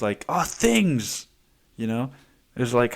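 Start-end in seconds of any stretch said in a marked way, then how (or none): 0.3-1.3 mocking spoiled kid. Mocking his younger self's holidays.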